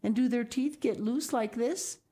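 put-on lightly high child's voice; curious little girl. A narrator's reading. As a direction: ask showing her tooth. Sal asks father.

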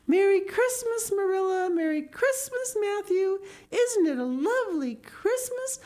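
put-on high, gushing girl voice, bursting with Christmas joy; excited Anne. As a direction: ring out the greetings high and thrilled.

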